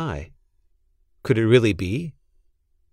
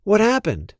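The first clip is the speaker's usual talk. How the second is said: surprised; 8 semitones higher than usual. A narrator's reading. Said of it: "said Alexander, surprised".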